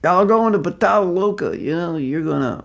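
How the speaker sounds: hammed-up doomsday street preaching; fire-and-brimstone preacher. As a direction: warn sinners like a street-corner Bible thumper. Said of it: "that was not the recommended preaching technique".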